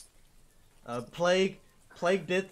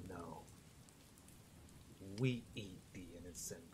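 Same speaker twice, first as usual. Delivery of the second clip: lightly angry [name]; 6 semitones lower, 15 dB quieter than usual. Acted. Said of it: "furrows his brows like he's angry".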